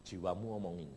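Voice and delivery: normal talking voice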